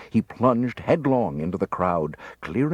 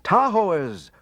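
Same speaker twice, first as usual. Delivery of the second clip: shouting; 10 semitones higher, 4 dB louder than usual. A narrator's reading. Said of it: "I shouted".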